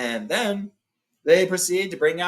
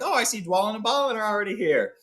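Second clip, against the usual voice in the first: dwarves' quote; 7 semitones higher than usual. Retold or imitated. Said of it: "and they're like".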